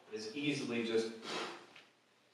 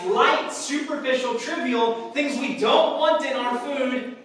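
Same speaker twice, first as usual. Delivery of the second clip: raised, louder preacher's cadence listing the chaff; preaching at full force; 10 semitones higher, 11 dB louder than usual. Acted. Preacher describing winnowing to congregation, raised and forceful. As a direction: build through the list like a sermon peak. Preaching the threshing floor image.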